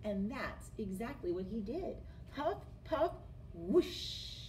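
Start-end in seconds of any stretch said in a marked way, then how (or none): 2.3-4.4 huff puff whoosh. A narrator's reading. Wolf blows.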